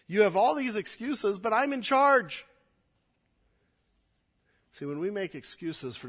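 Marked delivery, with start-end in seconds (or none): 0.1-2.5 voicing God, higher and louder; emphatic. Pastor voices God pounding into the people, emphatic. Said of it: "God here is pounding into them".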